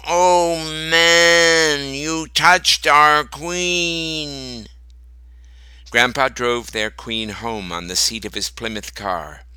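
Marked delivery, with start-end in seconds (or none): 0.1-4.7 high, slow, wailing bee voices; crying bees. A narrator's reading. Bees crying to Grandpa, slow high wail. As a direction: bees lamenting their stolen queen. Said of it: "I could hear them crying".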